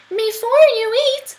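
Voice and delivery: high, loud clever mouse; squeaky mouse. Acted. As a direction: squeak a crafty offer.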